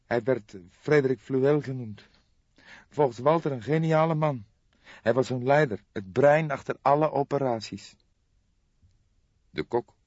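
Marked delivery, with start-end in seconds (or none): 0.1-7.9 put-on higher, tense young woman's voice; a shocked young woman breaking bad news; young woman Jolanda. Jolanda describes uncle. (geschokte jonge vrouw, hogere stem)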